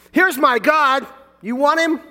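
"Here's my God" shouted high and possessive, as if God belongs to the speaker; loud, self-satisfied boast with the stress on "my"; wrong boastful reading. Shown as a demonstration. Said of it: "It's not what it says".

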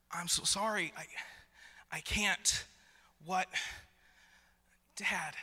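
helpless, broken-off little pleas to dad; overwhelmed child. Retold or imitated. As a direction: imitate a small child at a loss. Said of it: "in that moment where he was like".

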